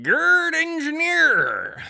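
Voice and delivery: high, loud, drawn-out name; hammed-up name announcement. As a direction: stretch out the name like a showy announcer. Calls out donor name theatrically.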